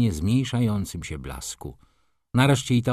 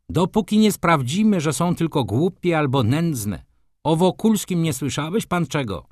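higher, louder irritated retort; 6 semitones higher, 3 dB louder than usual. A narrator's reading. Doctor grumbling to Ochocki about women, irritated. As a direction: carry on the annoyed reply. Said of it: "zirytowanym głosem".

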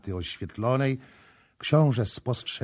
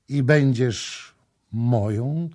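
ordinary delivery

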